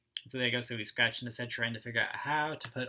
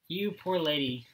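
slow, louder, kindly policeman pity; sympathetic policeman; 4 semitones higher, 6 dB louder, slower than usual. Acted. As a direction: comfort the poor old lady gently. Policeman pities the old lady.

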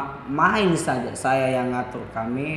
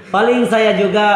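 laughing; 7 semitones higher, 6 dB louder than usual. Real, not acted. Laughs about wife content.

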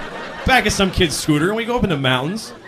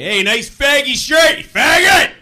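high mocking shout at a stranger; 12 semitones higher, 7 dB louder, much slower than usual. Stranger yells insults at me on the street, high shouting. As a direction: yell it at him from across the street. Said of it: "Total stranger walks up to me".

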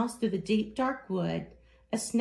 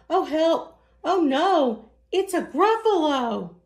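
high, loud alarmed shout; oh help, pitched-up terrified yelp; panicked cry; 7 semitones higher, 6 dB louder than usual. Acted. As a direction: cry out at the monster in front of you. Mouse meets the gruffalo.

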